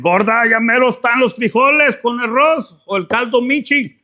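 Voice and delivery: loud, high, demanding call; hungry boyfriend. Pretend. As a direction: yell toward the kitchen. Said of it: "your dude's like".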